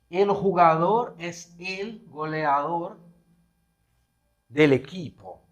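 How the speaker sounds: El jugador es el goleador spoken slowly; slow example sentence. Shown as a demonstration. Teacher reading an example sentence to students, slow and deliberate. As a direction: read the Spanish sentence slowly for the class. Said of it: "se pensamos algo assim".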